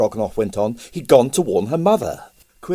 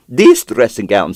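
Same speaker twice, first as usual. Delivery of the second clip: proudly; 10 dB louder than usual. Acted. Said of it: "said Pavel proudly".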